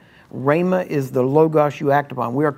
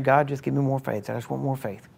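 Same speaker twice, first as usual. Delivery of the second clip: softer, fading into a hushed private prayer; praying under his breath; 7 dB quieter than usual. Retold or imitated. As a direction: pray it quietly so the wife keeps sleeping. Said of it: "praying under his breath".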